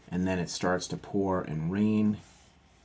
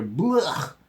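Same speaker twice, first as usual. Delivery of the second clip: disgusted; 8 semitones higher, 4 dB louder than usual. A narrator's reading. Brother disgusted.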